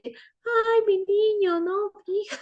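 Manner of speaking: high-pitched, cooing mock-tender voice; put-on sweet voice. Shown as a demonstration. Teacher showing what tone is not, put-on high cooing voice. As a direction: coo it in an exaggerated falsetto. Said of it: "tienen un vozarrón y entonces no, así".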